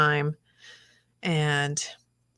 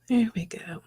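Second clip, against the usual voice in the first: whispering; 4 semitones higher, 3 dB quieter than usual. Real, not acted. Whispering to the artwork.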